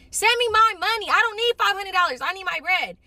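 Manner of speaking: a fed-up lender yelling at the friend for the money; mock angry demand. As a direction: hit it hard, demanding the money back. Demanding her money back, fed up.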